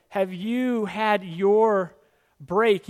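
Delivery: mock advertising voice, slow and loud; slow coaxing ad pitch. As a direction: stretch it out like a tempting commercial. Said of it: "It's kinda like".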